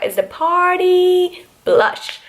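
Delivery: high, slow sing-song on Party Blush; drawn-out high sing-song; playful sing-song. Shown as a demonstration. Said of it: "I don't know why I said it like that".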